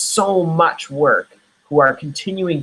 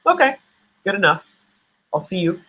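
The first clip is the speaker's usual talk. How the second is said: high, airy dismissive goodbye; breezy brush-off; 5 semitones higher, faster than usual. Pretend. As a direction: shrug it off lightly. Mock brush-off of rejection.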